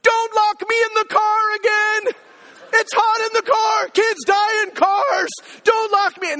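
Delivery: slow, high mock-desperate shout; hammed-up child's scream, drawn out on high notes; yelling kid. Son accuses dad before all of Target, yelling. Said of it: "he starts yelling".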